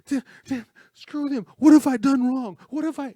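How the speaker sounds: very high, loud, anguished imitation; acted tearful, high-pitched cursing; imitated crying outburst. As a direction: cry out as if breaking down in tears. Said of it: "and he's crying. He's like".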